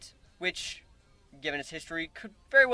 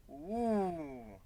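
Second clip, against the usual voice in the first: giggly ooh; 10 dB quieter than usual. Acted. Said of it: "She giggled and said".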